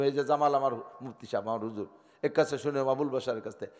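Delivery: ordinary delivery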